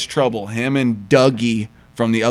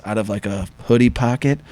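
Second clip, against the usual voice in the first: usual speaking voice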